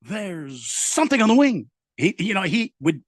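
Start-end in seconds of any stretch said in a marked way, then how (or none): 0.0-1.7 a hint of dramatic Shatner; lightly voiced Shatner line; mild mock Shatner drama; William Shatner impression. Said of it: "bust out the William Shatner".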